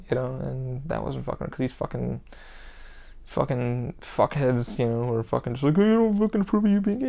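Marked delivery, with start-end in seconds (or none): 5.8-7.1 higher, quick sneering mimicry of a homophobe; mock them in a fast, higher, put-on voice; lightly voiced sneering bigot. Mocking the homophobes.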